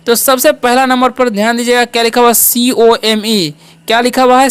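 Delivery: ordinary talk, nothing marked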